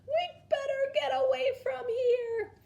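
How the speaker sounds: high, loud frightened squeak; tiny fireflies squeaking in fright, high and loud; squeaking in fear. A narrator's reading. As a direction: squeak it out, terrified and crying. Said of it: "squeaked in fear".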